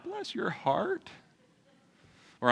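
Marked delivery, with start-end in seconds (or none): 0.0-1.1 slow, high, soft Southern pity; deep Southern accent. Acted. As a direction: draw it out like a cooing older Southern woman. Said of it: "say it with a deep Southern accent".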